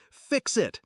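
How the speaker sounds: high-pitched, forceful angry bark; barked order. A narrator's reading. Furious father storms in on his child, barking. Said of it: "he barked".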